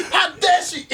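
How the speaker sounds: squeezing out the words through laughter; mock indignation between laughs; hammed-up outrage while laughing. Real, not acted.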